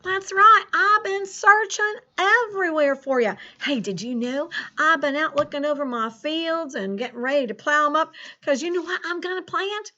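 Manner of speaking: put-on folksy rural American farmer accent; Farmer Ted's country accent. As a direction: chat to the kids in a hammed-up country farmer accent. Plays Farmer Ted throughout lesson.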